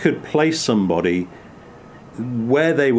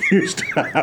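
laughing. Real, not acted. Laughing at ghastly drink memory.

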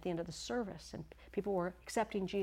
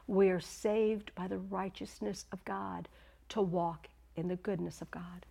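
ordinary talk, nothing marked

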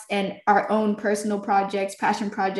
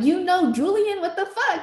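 surprised; 10 semitones higher than usual. Retold or imitated. Retold surprise at connection.

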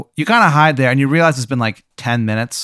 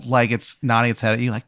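usual speaking voice

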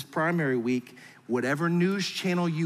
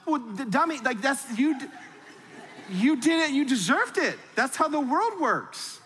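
high, teasing put-down; hammed-up exasperated parent; mock scolding; 8 semitones higher than usual. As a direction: scoff at the child's logic. Mocking reply to the kid.

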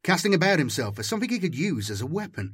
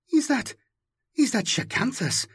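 high, halting, astonished question; stunned disbelief; 9 semitones higher than usual. Acted. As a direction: stammer it out in shock. Carlithra sees pilot, stunned.

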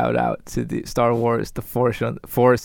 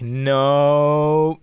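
mocking loud no; 4 semitones higher, 7 dB louder than usual. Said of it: "Disney was like".

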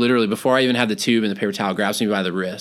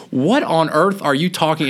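incredulous retort; 6 semitones higher, 3 dB louder, slower than usual. Retold or imitated. Baffled retort in retold argument.

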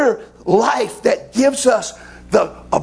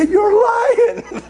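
laughing retort; 13 semitones higher than usual. Retold or imitated. Son answering his daddy's whooping speech, high and laughing. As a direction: squeal it back through laughter.

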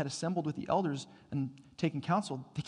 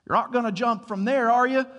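put-on worried old man; 8 semitones higher, 10 dB louder than usual. Old man warns cliff jumpers.

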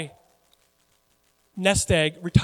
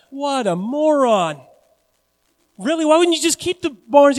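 mock disbelief; 7 semitones higher, 7 dB louder than usual. Real, not acted. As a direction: stretch out the insult in disbelief. Preacher mocks the man's plan.

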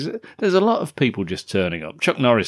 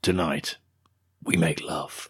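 mock film villain; 5 semitones lower, 6 dB quieter than usual. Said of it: "says Nico".

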